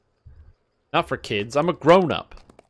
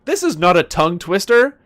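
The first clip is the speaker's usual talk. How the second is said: indignant scoff; 8 semitones higher, 6 dB louder than usual. Real, not acted. Scoffing at an easy rhyme.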